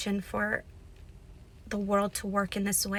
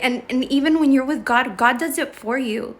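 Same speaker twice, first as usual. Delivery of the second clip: fervent emphasis; 3 semitones higher, 8 dB louder than usual. Real, not acted. Pep talk about God.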